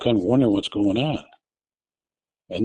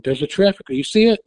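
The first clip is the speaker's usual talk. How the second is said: higher, louder warning; retold yelling; 8 semitones higher, 3 dB louder than usual. Wife yelling at her husband about the light, retold yell. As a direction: call the light out to him. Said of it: "I'm yelling at him".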